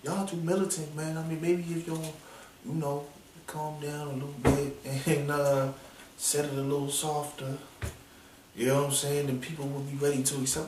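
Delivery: soft voice of a rapper urging calm; soft mocking quote. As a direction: keep it soft and placating, mocking him. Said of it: "he said it soft like that".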